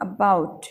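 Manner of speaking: About with weight on the b; About said a-BOUT; stress on second syllable. Shown as a demonstration. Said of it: "ब पे इतना stress".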